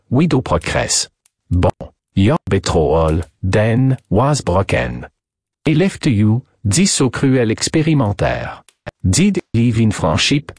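put-on thick French accent, deliberate phrasing; French detective, inquisitive. Detective questions the lady about her broken engagement, French-accented. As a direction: play an eager French investigator.

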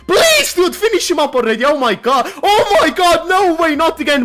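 high, loud pleading turning into a howl of disbelief; beg, then cry out no way; desperate yelling. Real, not acted. Opponent survives on nine HP.

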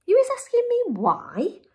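high-pitched, astonished giant's question; incredulous cry. A narrator's reading. Giant is questioned by Sophie, high incredulous cry. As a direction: cry it in disbelief, pitch leaping. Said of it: "cried the B F G".

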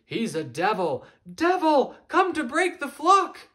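high, loud cries of a frightened mob; frenzied crowd shouting. Acted. The flock yelling at Fletcher, loud and frantic. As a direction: scream it like a flock gone mad. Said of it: "great clamor of squawks and screes".

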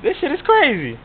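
usual speaking voice

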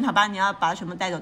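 ordinary delivery

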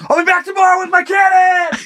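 high sustained comic yell; mock threatening holler. Shooter yells as his horse leaves, mock threatening holler. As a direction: holler it as if riding away.

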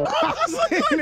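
high, stretched words through laughter; laughing hard. Real, not acted. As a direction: squeal it slowly while laughing. Still laughing at the fall.

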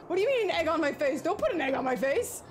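high, loud panicked protest; squealing, freaked-out complaint; scared. Real, not acted. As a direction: yell in alarm as things are thrown at you. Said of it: "I'm scared!".

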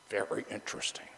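unvoiced, wry whisper; a hushed, wry aside. Real, not acted. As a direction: lean in and whisper it.

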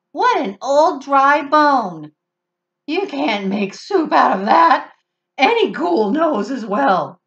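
a mocking ghoul, slow and loud; scoffing ghoul. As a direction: sneer at the bone, loud. Scornful ghoul voice.

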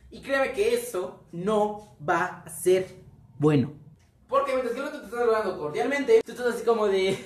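usual speaking voice

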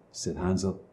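ordinary delivery, nothing marked

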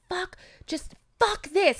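mock furious curse; mad outburst. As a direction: spit it out, shaking with rage. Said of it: "I was so mad".

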